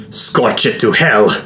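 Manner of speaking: wounded queen seething with rage. A narrator's reading. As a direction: spit out a drawn-out, furious curse. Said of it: "she seethed".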